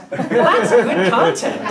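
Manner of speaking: slow, loud line through real laughter; laughing. Real, not acted. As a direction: drag it out while cracking up. Laughing at the zombie infographic.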